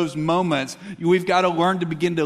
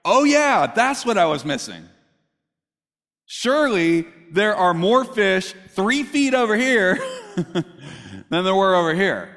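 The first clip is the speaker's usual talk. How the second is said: mock-grateful, louder sarcasm; scoffing fisherman Peter, laughing a little at the advice; sarcastic Peter; 3 dB louder than usual. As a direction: sneer at the stranger's advice. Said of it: "I'm sure Peter's like".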